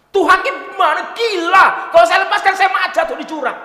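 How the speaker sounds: put-on loud, high Batak-accented protest, laughing. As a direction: shout in a Batak accent at God. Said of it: "gaya Batak ini ngomongnya". (protes keras dengan logat Batak sambil tertawa)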